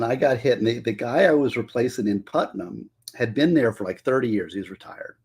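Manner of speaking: usual speaking voice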